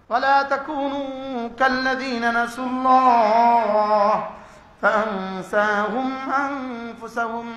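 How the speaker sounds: slow, melodic Arabic recitation; Quranic recitation. Acted. Preacher warning listeners with a slowly recited verse. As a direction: intone the verse with weight and slowness.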